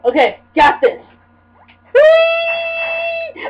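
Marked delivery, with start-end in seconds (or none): 1.9-3.5 long, very high "whee"; high playful squeal. Real, not acted. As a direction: squeal it out and hold it. Playful noise while jumping.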